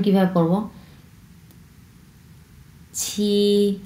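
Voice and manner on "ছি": ordinary delivery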